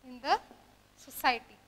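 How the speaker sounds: slow example reading, broken into pieces; dictation. Shown as a demonstration. Teacher dictating honorable example to students, slow dictation. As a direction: pause long between words as if writing them out.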